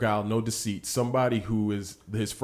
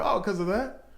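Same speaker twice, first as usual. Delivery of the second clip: high, mock-casual, then slowing; playful teasing; 7 semitones higher than usual. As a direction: toss it off playfully. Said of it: "Jesus being somewhat playful".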